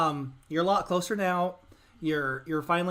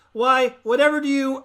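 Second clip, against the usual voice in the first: put-on Mona without her accent; 5 semitones higher, 8 dB louder than usual. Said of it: "she forgot the southern accent".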